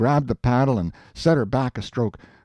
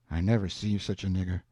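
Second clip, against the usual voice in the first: ordinary delivery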